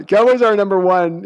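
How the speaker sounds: proud Cowboys owner boast, lightly done; a hint of Jerry Jones bragging; Jerry Jones impression. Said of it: "your Jerry Jones impression".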